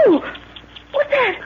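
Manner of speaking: high frightened question; alarmed cry. Acted. Lorna spotting the snake, high frightened cry.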